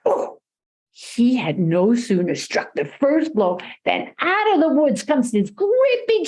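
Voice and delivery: ordinary delivery, nothing marked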